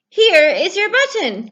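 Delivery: pleased Frog finding a button; excited cry. Acted. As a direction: call out the find happily. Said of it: "cried Frog".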